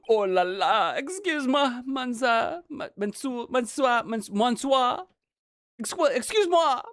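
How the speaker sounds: hammed-up French accent; stumbling over monsieur, French accent; French chef.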